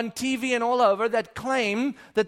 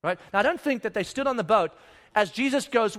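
ordinary talk, nothing marked

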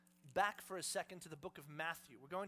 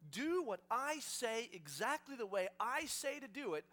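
high, emphatic, growing louder; building declaration; 5 semitones higher, 7 dB louder than usual. Acted. Jesus assuring followers he knows everything, building and emphatic. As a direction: build to a forceful proclamation.

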